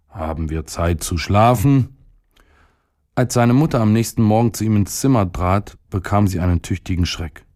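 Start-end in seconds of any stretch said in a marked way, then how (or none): none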